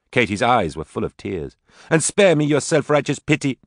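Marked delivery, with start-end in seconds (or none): 1.9-3.6 high bitter snap. Acted. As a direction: push the pity away. Tearful daughter angry at mother.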